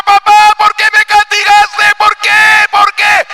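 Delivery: put-on high, loud wailing child's plea; crying child. Beaten boy cries to his father why, high loud wailing. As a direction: wail like a punished little boy. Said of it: "el niño llorando".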